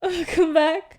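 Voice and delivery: breaking into laughter mid-greeting; hysterical laugh. Real, not acted. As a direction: welcome back through giggles. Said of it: "Why are you laughing like that?".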